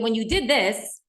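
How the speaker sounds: firm, pointed mock accusation; demonstrating a harsher, accusing delivery; accusing you-words. Shown as a demonstration. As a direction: put the blame on the listener, firmly. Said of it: "That comes across much differently".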